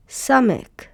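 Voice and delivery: teacher modeling the letter name Samech. Shown as a demonstration. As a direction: say Samech with a clear S and short e. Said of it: "Samech makes the S sound".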